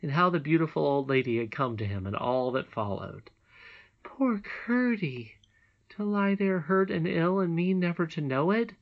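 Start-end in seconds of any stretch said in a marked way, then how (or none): 4.0-8.7 exclaiming in pity. A narrator's reading. Princess pitying hurt Curdie, exclaiming. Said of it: "exclaimed the princess".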